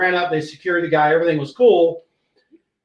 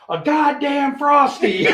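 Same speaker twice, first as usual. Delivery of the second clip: higher, slow, shouted through laughter; laughing exclamation; 8 semitones higher, slower than usual. Retold or imitated. Sergeant answering mocking colleagues, laughing shout. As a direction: blurt it out cracking up.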